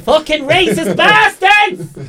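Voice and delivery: high, drawn-out shouted falsetto; hammed-up high-pitched yell; woman's voice. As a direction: holler it like an outraged woman from a car. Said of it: "There's that woman's voice again".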